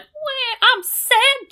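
high-pitched mock pout; mock-sad whine. Podcaster acting out sad Junior in the elevator, mock-sad whine. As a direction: whimper it in a squeaky, exaggerated voice.

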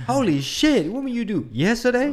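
shocked stranger, loud and high; loud shocked lady. Retold or imitated. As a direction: blurt it out in shock. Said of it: "'cause they'd be like".

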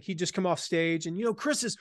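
normal talking voice

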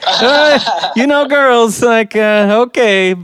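mock giving-in, high and gradually dropping; imitated father relenting. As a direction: give in to the begging, pitch sinking as you go. Imitating Borat giving in to daughter.

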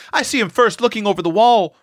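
put-on high, eager boy's boast; mild boyish roar. A narrator's reading. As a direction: call it out high, claiming the credit. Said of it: "roared Seth".

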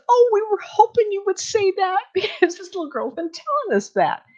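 high, excited rush of relief; gushing high-voiced mock relief; relieved outburst. Relieved woman reacting, arms thrown up.

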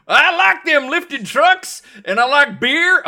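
put-on high, loud, slow country-boy voice; mock yokel. Podcaster joking about what he likes, mock yokel. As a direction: ham up a beer-loving truck fan. Joke answer as truck-loving yokel.

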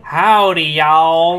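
loud Southern howdy greeting; Southern drawl, called out big; Southern accent. Shown as a demonstration. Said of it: "Can you talk in a Southern accent?".

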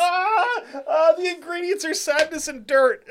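high laughing mock reply. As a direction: squeal it out through laughter. Mock cook answers, laughing.

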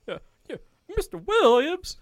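very high, loud mock nurse; imitated nurse. Nurse warning inmate about wipes, high mock alarm. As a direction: exclaim in a high-pitched put-on nurse voice. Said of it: "I just hear a nurse like".

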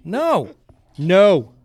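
loud sharp no to the dog; firm no. Real, not acted. Owner stopping the dog, loud firm no. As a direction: bark a command to stop her.

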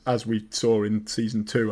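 ordinary talk

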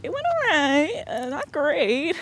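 high, slow, unimpressed; lukewarm verdict. Shown as a demonstration. Boss hinting the interview was mediocre, slow and lukewarm. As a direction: sound like it was just okay. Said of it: "saying, like".

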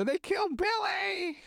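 high, slow, hammed-up fake wail; fake crying. Sammy fakes grief for everyone watching, high hammed-up wail. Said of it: "he starts pretending to cry".

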